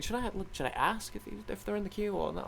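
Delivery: high, soft, tentative query; hesitant self-questioning. Retold or imitated. Gamer recalls debating whether to ask the crowd, hesitant. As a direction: wonder aloud in a small high voice.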